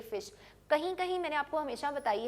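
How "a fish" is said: "a" in "a fish" said "ay"; letter-name A. Shown as a demonstration. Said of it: "Do not think कि". ("a" को "ए" बोलना)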